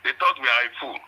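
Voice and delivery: ordinary talk, nothing marked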